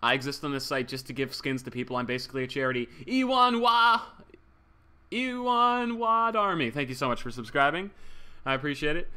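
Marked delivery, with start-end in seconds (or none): 3.1-6.7 high, loud cartoon villain cry; stuck in a cartoon character voice; Waluigi. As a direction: belt out the name, high and drawn out. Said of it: "got stuck in a state of Waluigi".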